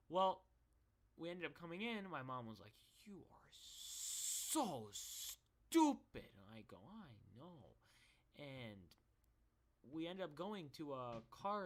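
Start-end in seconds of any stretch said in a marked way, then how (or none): 3.0-6.3 very slow, drawn-out mock disgust; exaggerated motherly dressing-down; scolding mom. 6.8-7.8 low, hushed sheepish reply; small, defeated acknowledgement; meek admission. Retold or imitated. Retold meek reply to scolding.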